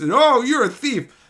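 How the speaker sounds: slow, high, loud mock accusation; mock all-caps yell. Streamer quotes trolls yelling at him in all caps, mock yelling. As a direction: stretch it out as an imitated troll yell. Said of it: "yelling at me in all caps".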